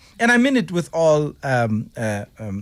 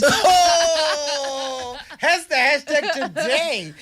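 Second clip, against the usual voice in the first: laughing exclaim; 7 semitones higher, much slower than usual. Real, not acted. Laughing outburst at hashtag.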